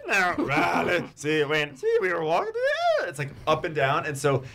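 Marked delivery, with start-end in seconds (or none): 0.1-3.1 a deliberately bad [name], pitch leaping up and down; [name] impression. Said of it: "my hardest impersonation is [name]".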